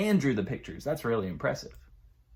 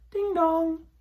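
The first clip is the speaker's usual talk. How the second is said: doorbell ding dong. A narrator's reading. Doorbell sound in story.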